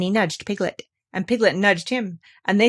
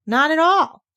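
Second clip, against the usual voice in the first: put-on boyish voice; 9 semitones higher, 7 dB louder than usual. Christopher Robin reacts, high.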